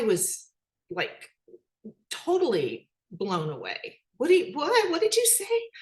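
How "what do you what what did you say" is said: high, loud stunned "what did you say?"; stunned disbelief. Retold or imitated. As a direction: gasp it out in total disbelief. Grandmother stunned by grandson.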